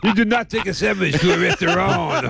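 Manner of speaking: amused mock-French echo; mimicked French accent. Radio host repeating the caller's punchline, mimicked French accent.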